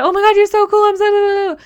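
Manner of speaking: high, loud starstruck squeal; squealing fan. Retold or imitated. A fan meeting someone cool, high loud squeal. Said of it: "instead of going to".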